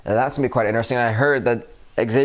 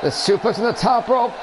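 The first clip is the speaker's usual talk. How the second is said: excited shout; 8 semitones higher than usual. Real, not acted. Excited play-by-play call.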